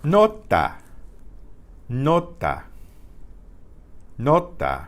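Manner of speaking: "Nota", clean vowels, no glide; Spanish pure vowels. Shown as a demonstration. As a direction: repeat "Nota" slowly as a model for the class. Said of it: "each vowel is its own pure sound".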